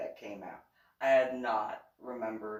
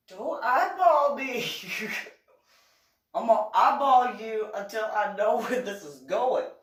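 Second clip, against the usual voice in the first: higher and louder, slowed, laughing through it; mock defiant retort; 9 semitones higher, 10 dB louder than usual. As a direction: playfully challenge him with a raised voice.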